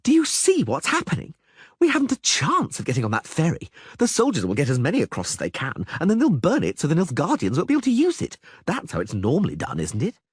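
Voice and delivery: high, worried poet; anxious bard. Acted. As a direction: ask fearfully.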